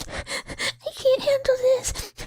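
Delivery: high, slow overwhelmed cry; imitating her own teary self high; mock overwhelmed squeak. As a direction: squeak it like you're overcome. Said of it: "clips are just me going".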